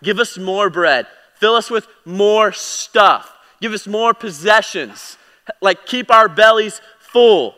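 demanding crowd. Retold or imitated. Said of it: "these crowds are like".

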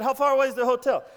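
loud, fast, urgent question. Retold or imitated. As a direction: fire it off in a hurry. Pastor urgently asks about license.

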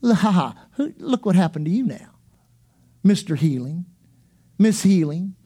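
low, sneering taunt; mocking devil. Devil taunting a sick believer, sneering. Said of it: "the devil, first thing he'll say is".

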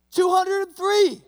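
high, loud and slow, spelling out every part; retrying the joke with a slow loud shout. Retold or imitated. New guy yells number again, slower.

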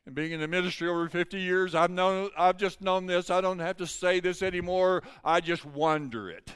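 pressing, high-pitched sermon voice; preaching at full force. Real, not acted. Preacher of over fifty years testifies, forceful preaching.

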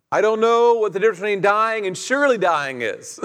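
ordinary talk

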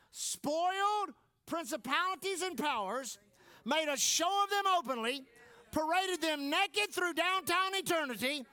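triumphant pulpit crescendo; rising preaching climax. Acted. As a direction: build it to a triumphant peak.

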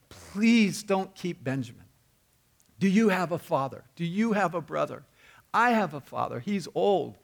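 a preacher acting out a brother's desperate plea; pleading brother. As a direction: plead with him for the boy. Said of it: "ends up pleading with him, begging him".

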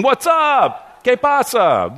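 high-pitched imitated hail; casual greeting. Preacher explaining the friend's greeting, imitated high and casual. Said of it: "He's just saying".

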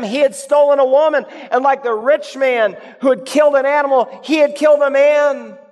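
preacher hammering the parallels; building fervour. Real, not acted. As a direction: drive each parallel home harder.

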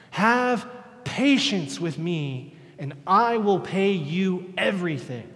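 slow, drawn-out pleading; a servant imploring his master; begging servant. Acted. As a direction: beg for time, hands out. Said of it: "imploring him, basically begging him".

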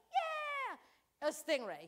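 high, loud mock cheer; mock excited. Family teasing dad's stingray, mock cheer. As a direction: cheer it like kids at the pier. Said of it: "We're like".

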